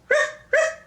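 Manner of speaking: high, loud imitated dog barks. Grandma showing grandchild how a dog goes, barking. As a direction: bark sharply like a dog. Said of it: "And a dog goes".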